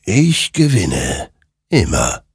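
slow, swooping spectral voice; triumphant creature. Acted. Creature claims every war.

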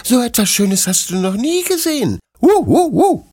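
high, eager little puppy voice, yipping; excited puppy Bruno. A narrator's reading. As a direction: bounce up and down like an overjoyed puppy. (aufgeregter kleiner Welpe, hohe Stimme)

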